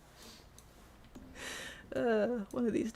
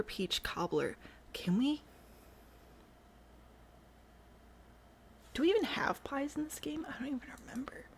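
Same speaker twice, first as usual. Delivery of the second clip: whispering; 5 semitones higher, 6 dB quieter than usual. Real, not acted. Whispered musing while building.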